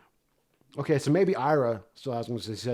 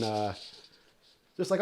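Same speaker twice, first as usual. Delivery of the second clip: ordinary talk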